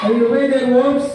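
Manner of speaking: slow, drawn-out chanted pledge; slow group recitation. Real, not acted. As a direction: recite the promise word by word. Class reciting a promise together.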